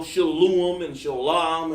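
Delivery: "shalom and shalom" said as sha-LU-om and SHO-lahm, mocking the wrong vowels; mock mispronounced "shalom and shalom" with O and U vowels; wrong pronunciations. Shown as a demonstration. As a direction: drawl the wrong O and U sounds in "shalom and shalom". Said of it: "There is no O sound in the Hebrew".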